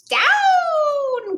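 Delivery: high sharp cry of "Down!"; cried out. A narrator's reading. Witch crying out to her cat to land. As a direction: call the broom down, high and urgent. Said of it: "cried the witch".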